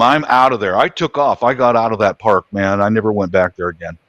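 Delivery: ordinary talk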